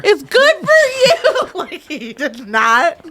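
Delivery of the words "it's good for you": very high, louder cheery insistence; high singsong insisting. Retold or imitated. Wife telling her sick husband it's good for him, high singsong. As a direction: sing it like it's obviously true.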